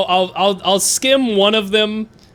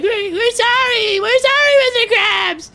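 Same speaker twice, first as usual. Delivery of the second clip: squeaky apology; 5 dB louder than usual. Retold or imitated. The boys apologising to their boss, squeaky and much higher. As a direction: whimper sorry like scared cartoon kids. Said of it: "And like".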